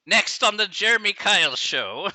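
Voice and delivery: big, loud, slow announcer voice; TV show announcer. Acted. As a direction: announce the next segment like a TV host.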